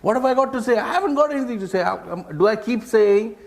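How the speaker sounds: high-pitched, mock-flustered novice speaker; mock panic, rising into a rapid high protest; worried novice speaker. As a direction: act out a speaker stuck for words, pitch climbing high. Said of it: "So you're wondering".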